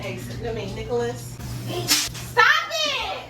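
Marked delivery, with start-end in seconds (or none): 1.9-3.2 high shout with a laugh. Real, not acted. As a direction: yelp stop it laughing. Firecracker thrown at her.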